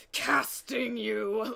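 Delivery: stretched-out, playful banshee cry; mock banshee swoop. Casting director swooping in on an actor, mock banshee cry. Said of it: "swoop in like a banshee and be like".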